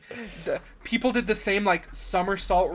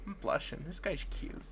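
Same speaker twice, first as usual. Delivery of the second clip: much softer, shy aside; bashful murmur; 13 dB quieter than usual. Retold or imitated. Fan admiring Christopher Reeve's eyes, bashful and hushed. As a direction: drop the volume as if privately smitten. Retells her smitten reaction to actor.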